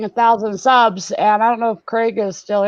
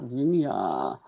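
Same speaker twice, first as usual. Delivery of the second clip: "Zinnia" mumbled much lower and softer; quiet mumble; 11 semitones lower, 10 dB quieter than usual. Shown as a demonstration. Trying out a viewer's name, quiet mumble. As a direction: murmur "Zinnia" to yourself, checking it. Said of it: "Adventures of Z-, Zinnia".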